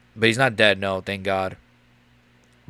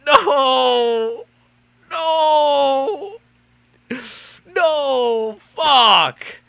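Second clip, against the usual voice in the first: high, loud anguished cries of no; real anguished yelling, higher and louder; anguished outrage; 8 semitones higher, 4 dB louder, much slower than usual. Real, not acted. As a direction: cry out as his fighter loses. His fighter just lost.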